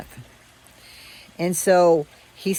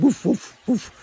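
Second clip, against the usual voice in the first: light woof, pitched up a bit; mock dog bark; 5 semitones higher, 3 dB louder than usual. Dog answering his boy with a light woof. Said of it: "He said".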